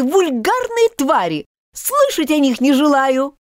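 high, disgusted mouse voice; proud offended mouse sneering at cats; indignant mouse. Acted. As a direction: spit out the insults with contempt.

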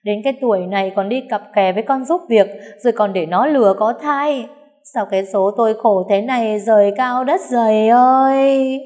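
sobbing out the complaint to heaven; a grieving old woman wailing to the sky; crying while speaking. A narrator's reading. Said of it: "vừa khóc vừa nói".

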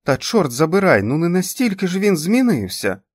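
loud, high-pitched cry of protest; exasperated shout. A narrator's reading. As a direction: shout it out into an empty room. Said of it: "крикнула вона у порожню кімнату".

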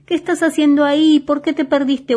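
reto en voz más alta y aguda; grítale enojado como un nene; grito enojado. A narrator's reading. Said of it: "Enojado, entonces Diego le gritó". (reclamo indignado de cuento infantil)

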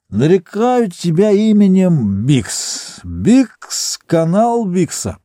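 put-on slow slurred drunken naming; drunk slurring. A narrator's reading. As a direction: drunk frat bro christening a canal. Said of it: "заплетающимся языком бормотал Бикс". (пьяное бормотание заплетающимся языком)

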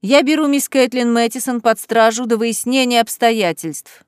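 usual speaking voice

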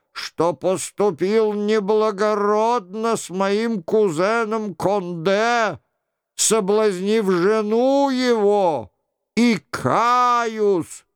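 high-pitched mock-royal confession; sing-song show of repentance; imitating the king. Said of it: "продолжал за Генриха Шико".

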